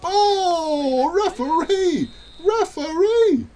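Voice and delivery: high, loud drawn-out yell; outraged appeal. Real, not acted. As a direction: cry out in protest to the referee. Protesting to referee during game.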